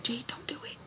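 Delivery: hushed inner-voice caution; whispered warning. Acted. Her body warning her not to do it, whispered warning. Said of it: "my body knows, like".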